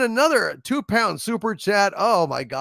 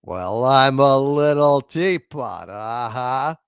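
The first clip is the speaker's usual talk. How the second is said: slow, lower cowboy-star voice; drawn-out movie cowboy impression, singing a nursery rhyme; John Wayne; 6 semitones lower, slower than usual. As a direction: croon it slowly, deep in character. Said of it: "John Wayne sings".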